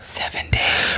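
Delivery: unvoiced whispered quip. Real, not acted. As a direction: breathe it out as a joke. Whispered joke reference.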